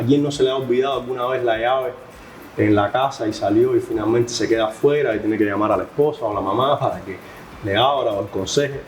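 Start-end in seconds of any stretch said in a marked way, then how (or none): none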